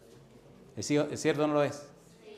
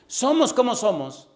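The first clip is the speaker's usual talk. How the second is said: higher, louder, slow; emphatic cry; 10 semitones higher, 10 dB louder, slower than usual. Acted. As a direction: hammer it home. Sermon emphasis. (con énfasis)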